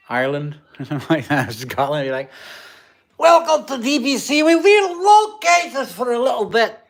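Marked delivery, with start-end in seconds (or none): 3.2-6.8 much higher, louder hammed-up ogre voice in a mock Scottish accent; cartoon ogre welcoming the stream, hammed-up; Shrek. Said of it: "That was Shrek".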